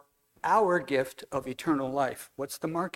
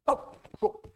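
usual speaking voice

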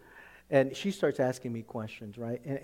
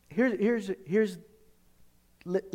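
normal talking voice

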